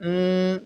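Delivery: "উম" hummed through the nose; nasal sound. Shown as a demonstration. Teacher showing the sakin nun sound, "উম" hummed nasally. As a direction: hold "উম" as a closed nasal hum. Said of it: "নাক দিয়ে এই আওয়াজটা করব".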